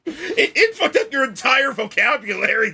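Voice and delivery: usual speaking voice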